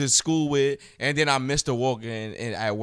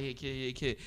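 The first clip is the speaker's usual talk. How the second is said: soft, dismissive retold aside; brushing it off; 11 dB quieter than usual. Teacher brushing off a student coming at him, dismissive. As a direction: wave the kid away quietly. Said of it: "I just was brushing it off".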